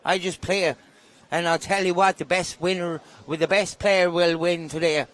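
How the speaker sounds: put-on Irish accent, slightly lifted. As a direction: play an Irishman talking in a thick brogue. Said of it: "That's a great Irish accent".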